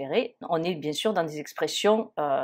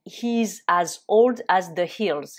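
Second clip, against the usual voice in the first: slow and a bit louder, every word set apart; slow model phrase; 4 dB louder, slower than usual. Shown as a demonstration. Teacher modelling the idiom slowly and louder for students. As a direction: lay it out slowly for learners to copy. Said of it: "on peut dire".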